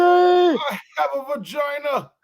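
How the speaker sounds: high, slow, loud mock scream; hammed-up holler. Host mimicking woman in clip, hammed-up holler. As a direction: drag it out in exaggerated outrage.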